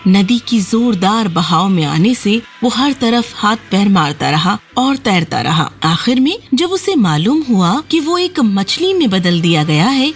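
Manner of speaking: ordinary delivery, nothing marked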